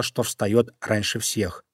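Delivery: ordinary delivery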